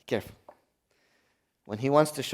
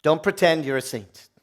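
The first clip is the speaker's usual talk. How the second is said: usual speaking voice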